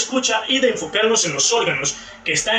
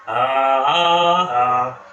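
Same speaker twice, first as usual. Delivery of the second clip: "ah" sostenida en tono monótono; sonido vocálico sostenido; 6 semitones lower, 3 dB louder, much slower than usual. Shown as a demonstration. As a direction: alarga cada "ah" como ejemplo fonético. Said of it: "los sonidos reales de la lengua". (vocal "ah" mantenida, demostración de sonido)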